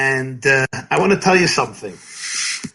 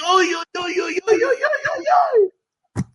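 high-pitched frantic "oy" wail; hammed-up hysterical oy-oy-oy; mock panicked wailing; 13 semitones higher than usual. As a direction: wail in exaggerated panic, climbing higher. Acting people who go crazy.

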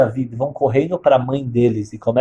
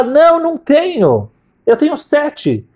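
high-pitched acted protest, drawn out; emphatic denial; 13 semitones higher, slower than usual. Shai denying to the prophet that he has another son, high emphatic protest.

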